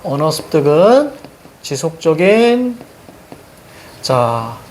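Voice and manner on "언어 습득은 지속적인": slow, "언어 습득은" and "지속적인" as separate chunks; demonstrated phrase reading. Shown as a demonstration. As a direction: draw out the endings of "언어 습득은" and "지속적인". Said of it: "그렇지, 이렇게 읽어야지".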